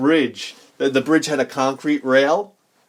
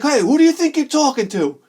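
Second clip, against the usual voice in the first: put-on angry old man; 9 semitones higher than usual. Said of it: "the guy was like".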